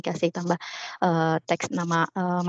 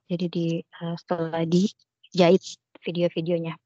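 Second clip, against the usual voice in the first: breaking up. Real, not acted. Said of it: "di saya putus-putus".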